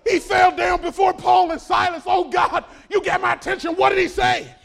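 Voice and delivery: loud, high, crying-out preacher; shouted preaching. Acted. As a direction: cry it out as the broken jailer. Preaching the jailer's plea.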